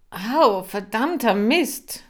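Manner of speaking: loud, high cry of pain and curse; yelp, then swear through it. Acted. Boris cursing loudly after Kira pinches his hand. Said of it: "fluchte er".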